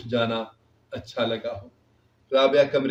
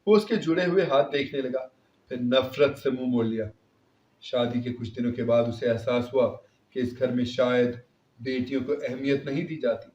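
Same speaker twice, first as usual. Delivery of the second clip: plain reading voice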